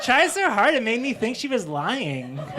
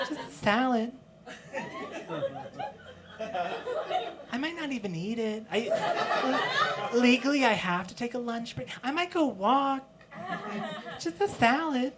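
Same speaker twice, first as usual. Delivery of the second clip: high-pitched little protest; Salad Cindy; 7 semitones higher, 5 dB quieter than usual. Acted. Boss insisting she barely eats, high voice. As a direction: lift it high, insisting lightly. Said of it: "really let us know that she eats healthy".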